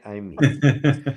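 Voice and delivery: said through a laugh, drawn out; I mean it, half laughing; laughing insistence. Real, not acted.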